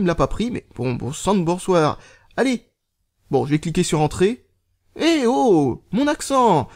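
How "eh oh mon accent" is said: high-pitched playful protest; mock indignation. Vlogger protesting to his phone over a missing accent, mock indignant. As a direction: call out in hammed-up outrage.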